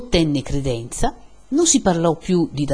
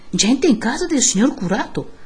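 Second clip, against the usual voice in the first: higher-pitched urgent announcement; raising the alarm; 7 semitones higher than usual. A narrator's reading. Sexton warns the villagers of intruders, raising the alarm.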